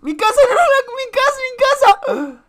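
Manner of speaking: high-pitched frantic outcry; high, urgent wail of protest; frantic cry. Acted. Frantic outcry about the house.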